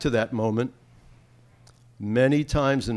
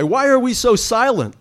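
indignant call; 11 semitones higher, 7 dB louder than usual. Pretend. Turning to classmates.

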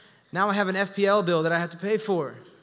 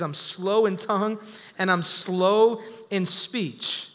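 drawn out at half pace; slow of speech; much slower than usual. Acted. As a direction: speak slowly, as one slow of tongue.

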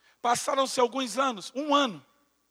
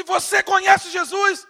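loud, slow, high-pitched question; shouting the question at the injured man; insistent shout; 8 semitones higher, 7 dB louder, slower than usual. Retold or imitated. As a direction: plead it loudly over the chaos. Asks again shouting.

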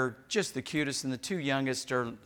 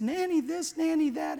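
put-on much higher childish whine; mimicking girls. Grandpa imitating granddaughters fighting over nanny, high. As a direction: whine it like squabbling little girls.

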